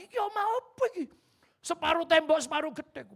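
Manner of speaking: high, fast, loud jeer; mocking villager. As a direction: sneer at the half-built house.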